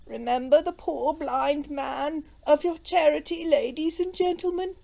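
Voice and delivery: high, wheedling beggar's cry; feeble beggar. A narrator's reading. Blind beggar calls to ladies and gentlemen, feeble. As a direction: plead for alms in a thin, high voice. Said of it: "more feeble tones of".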